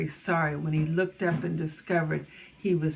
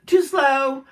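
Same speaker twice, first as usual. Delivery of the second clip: loud, higher-pitched gorilla outburst; gorilla interrupting; 6 semitones higher, 9 dB louder than usual. A narrator's reading. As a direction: cut in loudly as the impatient gorilla. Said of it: "before the gorilla interrupted".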